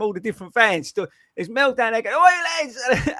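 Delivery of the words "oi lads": loud high shout across a crowd; calling out. Retold or imitated. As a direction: call out to mates far off.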